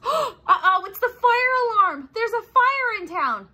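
high gasping uh-oh; startled by the alarm; sudden alarm at the fire. Acted. Fire alarm rings, alarmed narrator.

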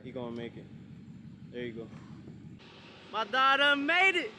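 ordinary delivery

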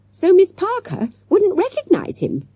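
put-on high-pitched child's voice; eager schoolgirl. A narrator's reading. Jessica adding to the children's excuse, high girlish voice.

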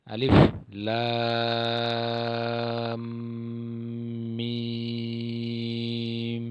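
alif lam mim, each letter stretched very long; drawn-out Arabic letters. Shown as a demonstration. Teacher drawing out each letter for students. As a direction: hold লাম and মিম long and steady. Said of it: "টান দিতে".

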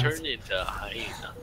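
whispering. Real, not acted. Laughing fit aside.

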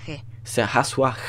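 azuare with a rasped Spanish r, slow. Shown as a demonstration. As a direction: slowly say azuare with a throat r. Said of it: "C'est genre pas un r, c'est un ré".

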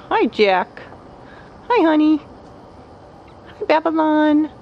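high cooing pet greeting; sing-song hello to birds. Real, not acted. Greeting parrots, pitch jumps up.

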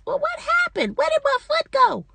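high, baffled mock wonder at her own fall; bewildered. She retells her fall, squeaking in baffled surprise. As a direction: play up the confusion, voice way up. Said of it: "'Cause I sure was like".

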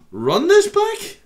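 high, loud disbelieving question; incredulous. Real, not acted. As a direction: ask for a replay in baffled disbelief. Baffled by accent in clip.

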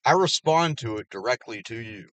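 usual speaking voice

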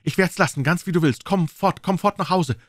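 high, eager assurance; relieved cry. A narrator's reading. Relieved wife urges husband to come home, calling out eagerly. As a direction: agree readily and urge him away. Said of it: "rief sie mit einem großen Seufzer der Erleichterung".

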